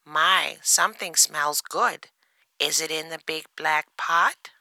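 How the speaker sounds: high-pitched duck character voice; storybook duck, high throughout. A narrator's reading. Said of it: "says Duck".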